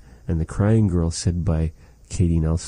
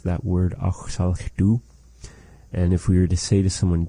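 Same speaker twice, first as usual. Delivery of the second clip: ordinary delivery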